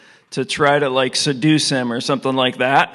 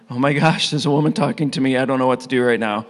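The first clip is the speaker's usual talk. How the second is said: flustered; 2 semitones lower, faster than usual. Retold or imitated. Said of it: "I would be one of them".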